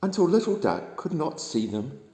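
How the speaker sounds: usual speaking voice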